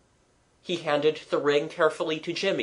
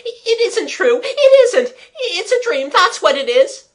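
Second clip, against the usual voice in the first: much higher and louder, a terrified shrieking denial; 11 dB louder than usual. Acted. Buried boy screaming at his brother in the dark, terrified shriek. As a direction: scream it like a child refusing the truth. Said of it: "His voice suddenly rose to a shriek".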